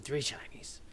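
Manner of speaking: muttered, unvoiced goblin. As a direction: mumble the offer greedily to himself.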